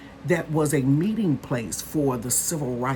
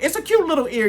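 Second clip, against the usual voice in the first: cute; 9 semitones higher, 3 dB louder, faster than usual. Real, not acted. Gushing over pig ear.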